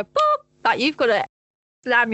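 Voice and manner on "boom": stamp the word out like slamming the brake; demo boom. Shown as a demonstration. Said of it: "you gotta go".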